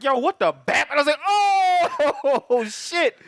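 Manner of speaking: high, laughing shock; laughing disbelief. Retold or imitated. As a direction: crack up in disbelief at the screen.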